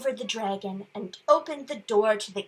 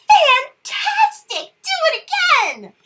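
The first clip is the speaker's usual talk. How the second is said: shouted; 10 dB louder than usual. A narrator's reading. Elizabeth shouting at the dragon, high and loud. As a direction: shout it to the sky, thrilled. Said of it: "Elizabeth shouted".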